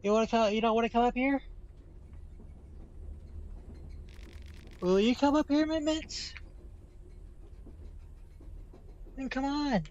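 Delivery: sweet high pet talk; coaxing a pet. Real, not acted. Coaxing the cat.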